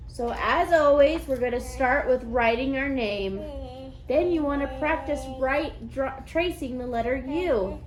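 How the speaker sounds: loud, bright classroom instructions; kids teacher voice. Acted. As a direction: address the whole class.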